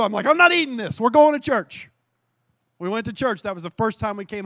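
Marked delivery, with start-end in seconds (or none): 0.3-1.9 higher, louder resolve; husband telling wife, louder and higher; fired-up declaration. Retold or imitated. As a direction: announce it with force.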